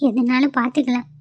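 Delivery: usual speaking voice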